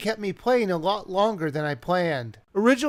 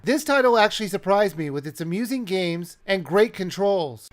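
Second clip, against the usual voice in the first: ordinary talk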